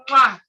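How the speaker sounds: smacking mwah kiss; loud air kiss. Real, not acted. Guest blowing a kiss to Brother Ash. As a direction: blow a big loud kiss.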